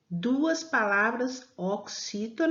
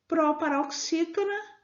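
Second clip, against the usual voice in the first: ordinary delivery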